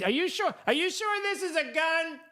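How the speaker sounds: high, silly voice asking if it's a gun; mock confused gun owner. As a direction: play a baffled fool fumbling with a shotgun. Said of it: "It's like".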